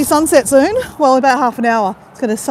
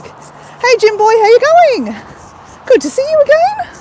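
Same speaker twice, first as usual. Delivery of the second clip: much higher, loud cheery hello; excited greeting; 11 semitones higher, 4 dB louder than usual. Real, not acted. Welcoming a regular viewer to the live, high and loud.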